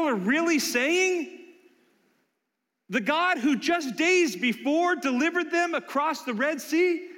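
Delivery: incredulous rebuke. Real, not acted. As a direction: preach it like you can't believe it. Preacher rebukes in disbelief.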